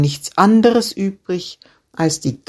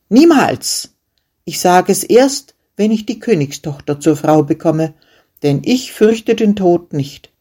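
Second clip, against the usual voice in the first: defiant refusal; 3 semitones higher than usual. Acted. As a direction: refuse him to his face, fearless.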